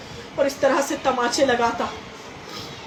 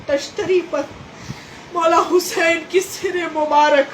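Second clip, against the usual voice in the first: impassioned lament preaching; 4 semitones higher than usual. Acted. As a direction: cry the tragedy out to the mourners, the voice climbing high. Majlis narration at full force. (ऊँची और तेज़ आवाज़ में दर्द भरा मजलिसी बयान)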